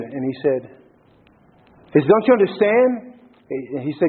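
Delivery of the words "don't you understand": pleading. A narrator's reading. Pleading with the freed slave.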